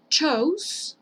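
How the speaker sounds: CHOSE drawn out as "chouz", rising; "o-u" diphthong. Shown as a demonstration. Teacher introducing the next verb form, long CHOSE. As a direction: glide from O to U in CHOSE. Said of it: "como si tuviera una O y una U".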